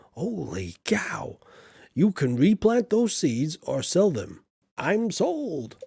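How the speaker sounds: hammed-up presenter with big pitch swoops; TV farm tipster. Show host telling viewers about sunflower seeds, hammed-up and swooping. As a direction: sell the farming tip like a show host.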